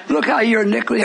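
ordinary talk

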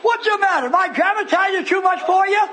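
high-pitched, teasing preacher's challenge; challenging the audience. Real, not acted. Preacher challenges his congregation.

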